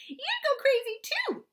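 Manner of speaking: very high, slow, loud near-bawling shout. A narrator's reading. Sister shrieking at her brother, nearly bawling, very high loud shout. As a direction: shriek it in tearful outrage. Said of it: "she shouted".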